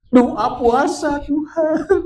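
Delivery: high, loud, drawn-out wail; tearful desperation. Retold or imitated. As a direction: cry out slowly to God in mock anguish. Acts out desperate fasting prayer. (ratapan tinggi memelas)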